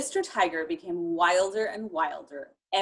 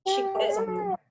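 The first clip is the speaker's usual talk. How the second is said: playful roar; 11 semitones higher than usual. Shown as a demonstration. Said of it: "good big roar".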